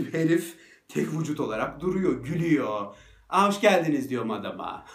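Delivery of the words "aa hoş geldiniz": higher and louder, breezy greeting; mock cheery welcome. Greeting the panicked dog owner, a breezy mock welcome. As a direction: acts casual, as if nothing happened. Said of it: "falan yapıyorum yani".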